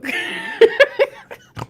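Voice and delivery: high, loud burst of relieved laughter; real laugh. Real, not acted. Reader laughing at how much came out, giddy. Relieved after finishing long reading.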